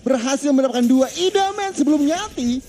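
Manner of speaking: hyped-up play-by-play, pitch way up; fighting game announcer. Real, not acted. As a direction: call the big fight at full hype. Climactic teamfight play-by-play call.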